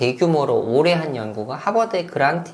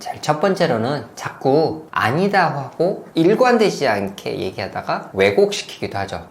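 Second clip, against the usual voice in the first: ordinary talk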